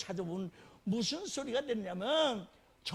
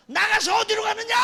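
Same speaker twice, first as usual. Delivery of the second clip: 나가서 shouted high and loud; preacher's shout; 10 semitones higher, 11 dB louder than usual. Acted. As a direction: boom 나가서 out to the congregation. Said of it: "repeated rhetorical question". (나가서를 외치듯 강하게)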